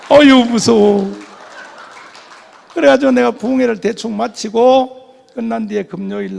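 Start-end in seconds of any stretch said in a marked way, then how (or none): 0.1-1.1 mock fright. Acts scared of senior pastors.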